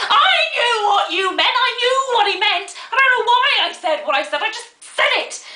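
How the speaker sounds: high, loud exasperated outburst; shrieked, defensive tirade; outraged shout. Acted. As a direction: explode in high-pitched indignation. Outburst at waiter, later called shouting.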